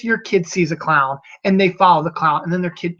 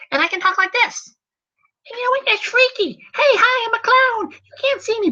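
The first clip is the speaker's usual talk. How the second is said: much higher, squeaky clown voice; high put-on voice. Shown as a demonstration. Showing viewers how a clown could disguise his voice, much higher. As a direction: push the pitch way up to disguise yourself. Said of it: "I could change my voice".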